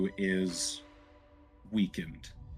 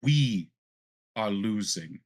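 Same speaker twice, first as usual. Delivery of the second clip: controlled indignation, key words driven home; impassioned plea with a touch of fury; indignant fury. A narrator's reading. Zadali urges Valen that her people need change, indignant. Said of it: "You can feel the indignation, the fury".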